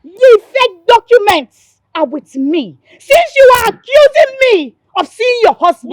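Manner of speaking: tearful furious shout, pitch climbing; sobbing yell of accusation; angry crying shout. Acted. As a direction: scream through tears at her accuser. Quarrel with rival woman.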